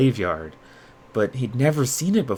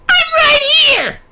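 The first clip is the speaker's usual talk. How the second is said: high, loud and slow creature yell; Grim shouting; 8 dB louder, slower than usual. A narrator's reading. Grim yells up at Yuya who can't see him, high and slow. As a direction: drag it out, furious he's overlooked. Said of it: "the voice snarled from below".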